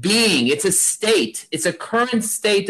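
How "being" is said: Being said slowly, set apart by a pause; stress on being. Shown as a demonstration. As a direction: hold Being alone, then pause. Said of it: "I'm gonna underline the word being".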